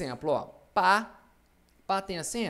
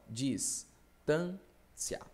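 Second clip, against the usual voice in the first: dis-tân-cia, slow with gaps between syllables; 3 dB quieter, much slower than usual. Shown as a demonstration. Teacher splitting a word for the class, syllable by syllable. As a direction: break "Distância" into separate, drawn-out syllables. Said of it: "vou separar as sílabas".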